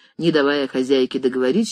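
plain reading voice

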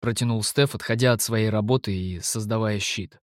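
usual speaking voice

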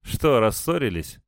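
teasing smirk. A narrator's reading. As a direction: probe with a little smirk. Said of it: "с усмешкой поинтересовался Волошка". (с лёгкой усмешкой)

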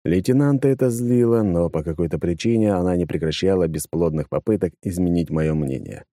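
plain reading voice

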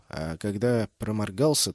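plain reading voice

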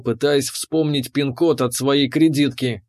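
ordinary delivery, nothing marked

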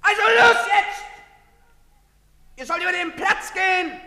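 loud, high barked commands; shouted orders. Acted. Soldier shouting at the Andorrans to cross, loud commands. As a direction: drive the crowd across the square.

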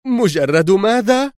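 high, drawn-out incredulous question; shocked woman's rising cry. A narrator's reading. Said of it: "وهي تهتف".

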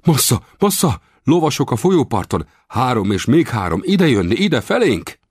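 high-pitched breathless-news warning cry; excited lookout's warning in a high voice; urgent alarm. A narrator's reading. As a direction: raise the alarm, pitched well up. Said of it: "ezzel a hírrel riasztott fel".

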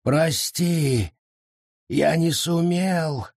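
anguished apology; despairing cry. Acted. Forest despairs over dying tree.

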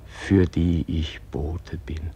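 breathy whisper closing the prayer. Acted. Praying man whispers to God, hushed. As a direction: hush it like a secret to God.

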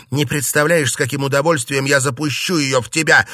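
loud, heated outburst; cried out. A narrator's reading. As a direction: shout the threat at him. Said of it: "выкрикнула она".

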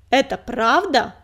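slow, loud, threatening demand; menacing. A narrator's reading. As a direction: lean in and press her. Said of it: "угрожающе наклонился".

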